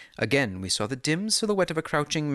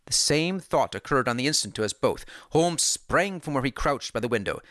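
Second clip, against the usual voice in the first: ordinary delivery, nothing marked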